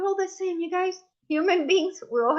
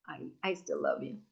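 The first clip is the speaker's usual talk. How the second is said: soft loving line; 8 semitones lower, 9 dB quieter than usual. Retold or imitated. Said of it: "what we all want to hear is".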